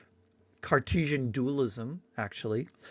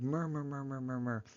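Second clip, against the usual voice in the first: imitated water; 10 dB quieter, faster than usual. Shown as a demonstration. Early humans copying wet things, imitated babbling. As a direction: let "murmur" babble quietly like a brook. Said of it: "all these wet things make sound like".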